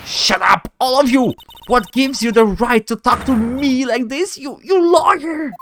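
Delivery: high-pitched indignant outburst; acted high, affronted tirade; outraged witness. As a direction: lash out at the whole courtroom in a high voice. Voiced witness snaps at lawyers.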